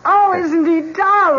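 very high, loud gushing; gushing squeal. Acted. Wife gushing over the new dog, high and loud. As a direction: coo loudly over the puppy.

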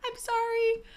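ordinary talk, nothing marked

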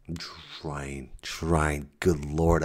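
ordinary talk

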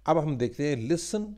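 ordinary talk

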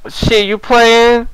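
high, drawn-out gangster put-on; mock gangster. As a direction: stretch it out like a wannabe gangster. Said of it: "just act like a gangster".

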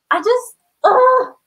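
drawn-out high, loud ugh of frustration; a slow, loud fed-up ugh; exasperated groan. Real, not acted. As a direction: let it out slowly, fed up.

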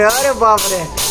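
ordinary talk

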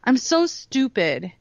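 lightly hammed-up kid's complaint; retold self-putdown. Daughter in the car before carpool, retold self-putdown. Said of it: "she's like".

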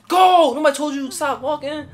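very loud, high shout; explosive high-pitched command; yelled order. Real, not acted. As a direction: yell at them to get moving. Yelling at character to keep moving.

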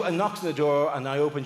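ordinary delivery

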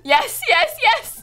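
high, loud bursts of yes; excited cheering. Real, not acted. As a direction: celebrate every coin out loud. Coins coming in during game.

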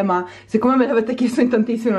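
ordinary talk, nothing marked

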